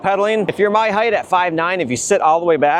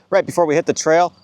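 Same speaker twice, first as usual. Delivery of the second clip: normal talking voice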